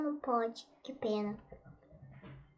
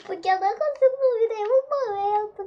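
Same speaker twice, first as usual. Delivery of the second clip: scared; 8 semitones higher, 7 dB louder, faster than usual. Real, not acted. Frightened outburst while playing.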